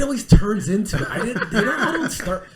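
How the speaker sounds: high, loud laughing denial; laughing protest. Real, not acted.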